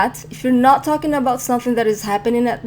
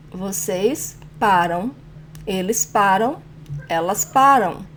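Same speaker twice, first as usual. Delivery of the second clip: measured conjugation drill, PA strong in "paro", "para", "param", ra strong in "paramos"; paced model reading, endings of "paro", "para", "param" left weak; stress second-to-last syllable. Shown as a demonstration. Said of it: "The stronger syllable is the second to last".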